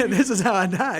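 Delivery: chuckling disbelief at almost dying; laughing through it. Retold or imitated. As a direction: laugh at the memory of nearly dying. Retelling a crash, laughing.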